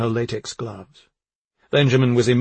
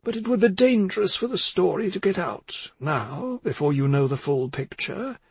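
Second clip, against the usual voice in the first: higher-pitched character voice, measured; cautioning older woman; 7 semitones higher than usual. A narrator's reading. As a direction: voice a woman carefully warning her listeners.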